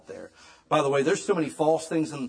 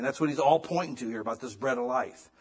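ordinary delivery

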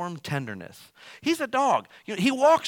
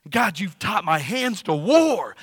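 slow, louder, higher warrior boast; proud warrior voice; 5 semitones higher, 6 dB louder, slower than usual. Retold or imitated. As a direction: declare it like a battle-hardened king. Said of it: "He's talking like this".

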